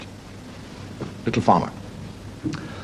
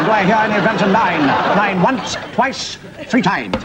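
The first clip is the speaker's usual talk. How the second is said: higher auctioneer's call for bids; mock auctioneer; 6 semitones higher, slower than usual. Judge auctioning off a prison sentence, auctioneer's calling. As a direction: work the room like an auctioneer.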